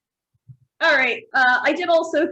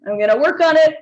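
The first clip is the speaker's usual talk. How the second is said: much louder, higher insistence; emphatic promise; 4 semitones higher, 9 dB louder, faster than usual. Real, not acted. Host promising Hamilton a better pitch, emphatic promise. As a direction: punch it up as a loud assurance.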